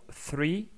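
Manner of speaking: "three" with a clear English th, tongue between teeth. Shown as a demonstration. Teacher modelling "three" for French learners. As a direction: say "three" with an unvoiced th. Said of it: "dans le mot".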